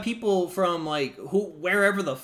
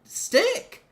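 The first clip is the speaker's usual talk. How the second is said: mock innocent dad; 11 semitones higher than usual. Dad faking innocence to bystanders, higher voice. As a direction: play dumb innocently. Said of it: "And I'll be like".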